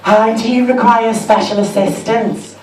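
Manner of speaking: sweet customer-service tone; a hint of a patronising shop assistant; sugary staff voice. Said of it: "she went".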